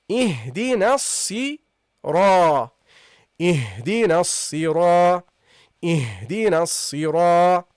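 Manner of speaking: الصراط with doubled s and long dark ra, stopping before the ط; prolonged ra. Shown as a demonstration. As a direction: draw out the heavy ra in الصراط. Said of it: "with the fatha prolonged by the small alif".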